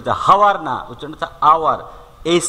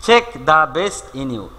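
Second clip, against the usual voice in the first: slow, deliberate reading of the example; 3 dB louder, much slower than usual. Shown as a demonstration. Teacher reading an example to students, slowly. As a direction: spell it out slowly for the class. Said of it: "teacher reads proverb slowly".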